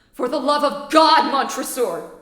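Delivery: loud begging cry; desperate plea. Acted. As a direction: beg loudly to be freed. Trapped man begs.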